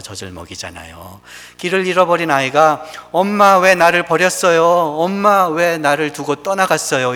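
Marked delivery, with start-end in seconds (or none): 3.1-7.1 put-on loud, pleading call of a lost child; lost child crying out. Lost child calling its mother, loud and pleading. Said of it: "이렇게 소리 지르며".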